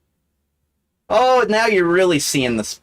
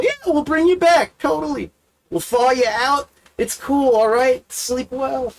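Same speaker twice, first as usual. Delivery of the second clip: higher, breezy fake reassurance from a cryonics firm; mock reassuring salesman; 7 semitones higher than usual. Cryonics company soothing a client, higher breezy fake reassurance. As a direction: promise to thaw them out, hollow and chipper.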